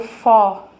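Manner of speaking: "fó" with a loud, open O; stressed syllable "fó" alone, open and loud; open vowel. Shown as a demonstration. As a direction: sound out "fó" with the O wide open. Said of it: "o ó é aberto".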